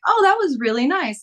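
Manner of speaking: high, loud polite gush; polite thanks. Retold or imitated. Thanking the tenant for offering pizza, bright and high. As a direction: sound pleasantly touched. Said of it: "And I was like".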